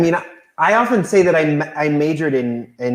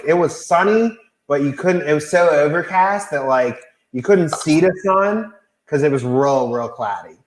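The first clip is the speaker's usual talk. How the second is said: put-on Pittsburgh accent. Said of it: "can we get your best Pittsburgh accent".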